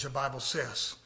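normal talking voice

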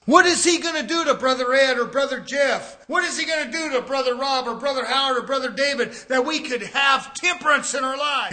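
loud, driving pulpit call, fast in the middle; preaching hard. Acted. Preacher calling out brothers by name, loud and driving. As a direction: hammer the names out to the pews.

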